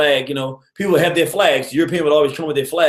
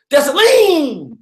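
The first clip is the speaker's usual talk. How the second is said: loud proud shout; 9 semitones higher, 5 dB louder, much slower than usual. Retold or imitated. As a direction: shout the name out long and high. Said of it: "She gotta say his name loud".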